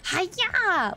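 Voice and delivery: sharp high battle yell; battle cry. Acted. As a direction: shout it like a sword swing. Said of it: "you have to make noises".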